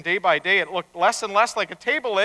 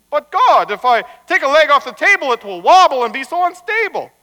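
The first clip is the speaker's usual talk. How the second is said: higher, loud anxious objection; protesting rabbi; 7 semitones higher, 9 dB louder than usual. Acted. Rabbi objecting to God about the table leg, protesting. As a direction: plead the case loudly, pitched up.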